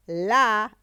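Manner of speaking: "La" held long and loud; stressed syllable. Shown as a demonstration. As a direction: punch and stretch "La" as a stressed syllable. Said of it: "es la sílaba tónica". ("La" alargada y con énfasis fuerte)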